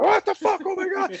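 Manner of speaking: high panicked yell, pitch shooting up; retold panicked scream. Passenger on a diving plane screaming in panic. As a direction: shriek it like waking to water below. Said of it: "And I start screaming".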